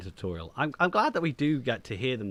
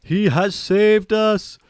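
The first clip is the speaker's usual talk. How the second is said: loud, high, drawn-out hero's hail; mock triumphant shout; 10 semitones higher, 11 dB louder, much slower than usual. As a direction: proclaim it hugely, stretching every word. Mock hailing of the captain.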